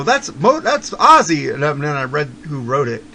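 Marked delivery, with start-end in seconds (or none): 0.0-1.5 surprised exclaim. Retold or imitated. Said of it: "I go".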